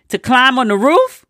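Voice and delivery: retold disbelief, pitch lifting high on the roof; incredulous question. Niece questioning her elderly aunt's escape plan, incredulous. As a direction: question the plan as if it were absurd.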